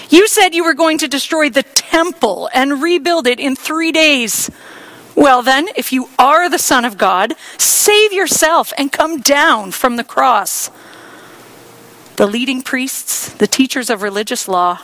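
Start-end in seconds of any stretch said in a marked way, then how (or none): 0.1-10.7 higher, loud taunting; jeering shout. Acted. Crowd shouting abuse at Jesus on the cross, jeering. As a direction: dare him to come down. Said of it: "shouted abuse".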